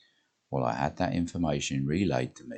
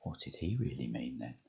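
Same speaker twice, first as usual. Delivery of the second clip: whispered question; 4 semitones lower, 9 dB quieter than usual. A narrator's reading. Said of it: "before Jane whispered".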